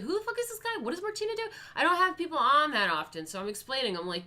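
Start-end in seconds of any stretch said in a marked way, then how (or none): 0.0-1.4 raised, confused viewer voice; lightly voiced confused viewer; puzzled viewer. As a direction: play a baffled viewer, pitched up. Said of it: "They're just like".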